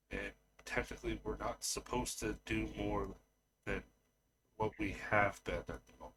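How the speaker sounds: soft, halting answer in character; hesitant Genesis. Acted. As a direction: explain it carefully, quietly, with long pauses. Game master plays Genesis.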